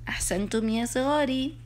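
ordinary delivery

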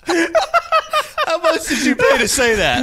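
slow, high, laughing disbelief. Real, not acted. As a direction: ask it while cracking up. Reacting to roast donation.

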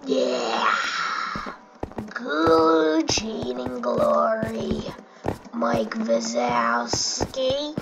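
long drawn-out, very slow gloating; slow-motion boast of a cheater; mock cheating YouTuber. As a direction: stretch every word out slowly in mockery. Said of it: "went back to survival and like".

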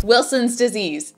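loud, slow, triumphant quiz answer; game show answer. Pretend. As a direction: call out the answer like a winning contestant. Answering Diagnosis Jeopardy like a contestant.

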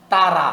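"তা রা" with "aa" in both syllables; wrong open vowel. Shown as a demonstration. Teacher showing the other mistake, "তা রা" as "taa-raa". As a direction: say "রা" open, without rounding it. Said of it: "দুটাই ভুল".

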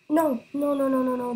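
desperate no, no, no; pleading the ball stays out; anguished denial. Real, not acted. Goal about to be conceded.

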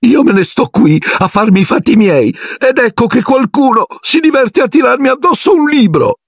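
a sulky ghost working herself up to shouting; aggrieved shout. Acted. Ghost girl complaining to the boys about the book, high and building to a yell. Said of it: "gridò Mirtilla".

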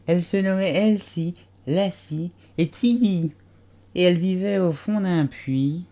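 a dozy little animal insisting it was awake; sleepy Dormouse. A narrator's reading. Dormouse defending himself to the tea party, drowsy and high. Dormouse telling his tale.

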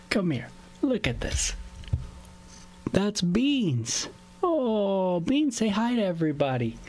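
high-pitched pet talk; coaxing a pet. Real, not acted. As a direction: fondly coax the pet over and show it off. Coaxing and introducing pet Beans.

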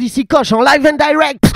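loud, high show-opening announcement; hyped intro. Acted. Host hyping the live show to viewers, excited. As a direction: announce yourself big and loud.

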